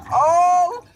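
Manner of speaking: loud, stunned exclamation; shocked. Retold or imitated. Realizing the video was sent, loud stunned shock.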